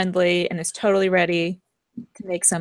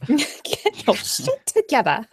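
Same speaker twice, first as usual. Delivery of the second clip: laughing; 3 semitones higher, slower than usual. Pretend. Repeats line laughing.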